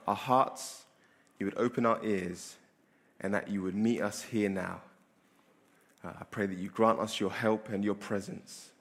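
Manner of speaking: soft, low prayer voice. Acted. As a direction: pray quietly and humbly. Leads the congregation in prayer.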